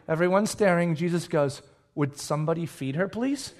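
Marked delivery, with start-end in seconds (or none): 1.9-3.5 soft, low, lifting on please; deadpan hushed polite plea; understated request. Acted. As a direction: ask quietly with a rising final word. Voices Jesus's understated request.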